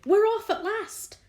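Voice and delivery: high, loud joyful cry; excited shout. A narrator's reading. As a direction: cheer it out at last. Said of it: "someone was shouting".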